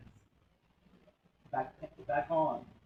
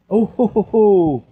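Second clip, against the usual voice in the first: booming triumphant ho ho ho laugh; 6 semitones higher, 21 dB louder, much slower than usual. Real, not acted. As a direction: roar out a gloating laugh. Loud triumphant gaming moment.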